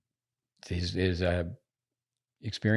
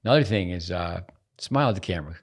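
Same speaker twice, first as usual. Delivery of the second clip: ordinary talk, nothing marked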